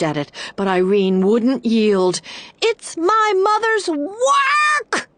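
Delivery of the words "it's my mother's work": very high, slow desperate scream. A narrator's reading. Irene fighting for the box, high scream. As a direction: scream it over the wind. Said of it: "she screamed".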